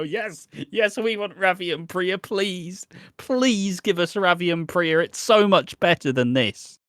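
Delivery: high, gushing fake begging; mock fan pleading. Fans begging producers for Ravi and Priya, mock pleading. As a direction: plead like an over-eager fan, hammed up.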